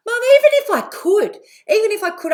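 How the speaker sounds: put-on high, loud little boy saying it in earnest; child's earnest voice. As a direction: play her young son, pleading and sincere.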